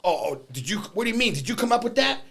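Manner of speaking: mock a teacher suddenly unsure of himself; imitating a startled teacher. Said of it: "he goes".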